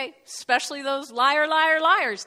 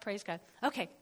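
ordinary delivery

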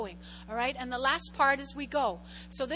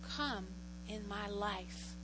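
soft model prayer; 4 semitones lower, 9 dB quieter, much slower than usual. Shown as a demonstration. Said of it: "say, say".

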